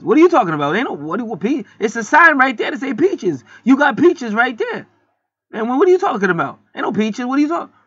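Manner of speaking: high, loud incredulous voice; mock indignant clerk. The clerk denying there are peaches, high and incredulous. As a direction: protest in disbelief like the clerk. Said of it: "they said".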